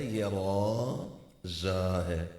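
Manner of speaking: را زا drawn out slowly; letter names drawn out; slow spelled letters. Shown as a demonstration. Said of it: "اور یہ جو زا ہے".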